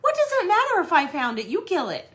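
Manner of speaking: high, loud protest falling at the end; hammed-up indignant comeback; retold squabble. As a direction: argue back in mock exasperation. Acted spouse quarrel over spider.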